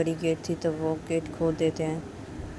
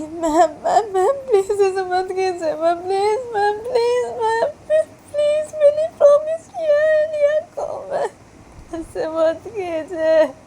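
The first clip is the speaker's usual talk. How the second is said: very high, loud wailing plea; crying shout; 9 dB louder, slower than usual. Acted. Girl screaming and crying after the departing mistress. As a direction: wail after her as she leaves. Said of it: "चिल्ला के बोलती कि".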